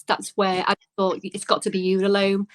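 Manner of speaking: normal talking voice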